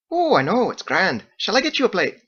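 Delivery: high, bright-toned Irish-style hostess; Margot's lilting voice. A narrator's reading. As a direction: play the chatty innkeeper woman, high.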